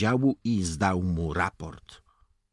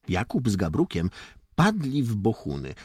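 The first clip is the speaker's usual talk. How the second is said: ordinary delivery, nothing marked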